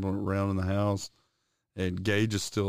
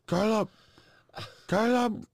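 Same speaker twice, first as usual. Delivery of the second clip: high calling of a name; 13 semitones higher than usual. Retold or imitated. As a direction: call across the yard. Friend calling out in yard.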